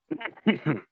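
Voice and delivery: short high choking cough; lingering cough. Real, not acted. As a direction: cough out the last of the water.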